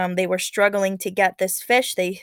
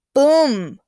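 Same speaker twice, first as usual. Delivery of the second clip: louder, higher, punched Boom; dramatic boom; 6 semitones higher, 9 dB louder than usual. A narrator's reading. Teacher acting the fish's bite, dramatic loud boom. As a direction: make the bite hit hard. Sound in story.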